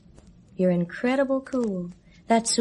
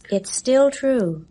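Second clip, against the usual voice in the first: slow and louder, each word set down; firm, slow, raised insistence; stubborn insistence; 4 semitones higher, 4 dB louder, slower than usual. Acted. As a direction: dig in and insist. Insisting against his dismissal.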